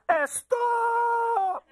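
put-on piercing accented shout, high and drawn out; accented yell with an extra "Eh" before a long "stop"; dad's heavily accented yell. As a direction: imitate dad hollering with a thick accent. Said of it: "my father has a really bad accent".

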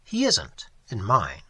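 ordinary delivery, nothing marked

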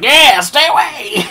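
high, loud drawn-out warning, half laughing; shouting mountain. Pretend. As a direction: play a mountain hollering people off. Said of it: "Mountain's like".

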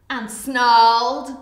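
loud, drawn-out snarl on the word. Pretend. Storyteller acting out the crocodile, snarling. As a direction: make "snarled" a slow snarl.

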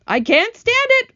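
high, loud panicked wail. A narrator's reading. Earthworm unable to bear the danger, wailing. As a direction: wail it out at the top of the range. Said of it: "wailed the earthworm".

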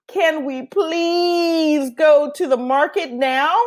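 high, slow drawn-out plea; a tired little boy begging; pleading boy. Acted. Pablo pleading with Abuela, slow and whiny.

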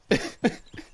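stifled giggle; airy laugh; breathy laugh. Real, not acted. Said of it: "I can't stop laughing at the moment".

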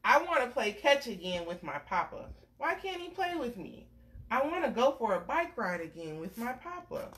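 gentle storybook read-aloud; reading to a child. Acted. Parent reading a picture book to a little one, gentle storytelling.

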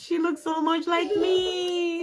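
high near-tearful gushing; excited squeal. Real, not acted. Overjoyed at cute stickers.

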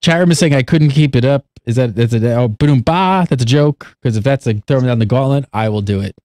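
low lisping voice, teeth on tongue. Pretend. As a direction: keep the low lisp going. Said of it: "talk with that lisp".

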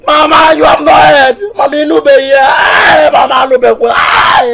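loud frantic warning; panicked shouting. Acted. Son yelling at mother that she must not stay, panicked shouting. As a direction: shout the news at her.

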